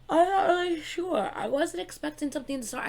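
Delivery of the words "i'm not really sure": talking through a yawn. Real, not acted. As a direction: say it mid-yawn, sleepy. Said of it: "I'm so sorry I keep yawning".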